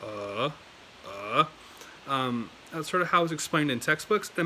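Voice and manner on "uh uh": "uh" sunk low, rising slightly at the end; low dipping "uh"; low third tone. Shown as a demonstration. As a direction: dip down on "uh", then lift a little. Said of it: "is, is, is lower".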